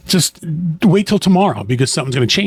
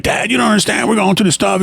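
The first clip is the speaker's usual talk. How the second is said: higher, urgent pleading with his father; anxious plea to dad; 6 semitones higher than usual. Retold or imitated. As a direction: push it like a worried son who needs to be heard. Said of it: "I'd be like".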